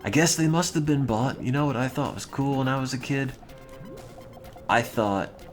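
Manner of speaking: normal talking voice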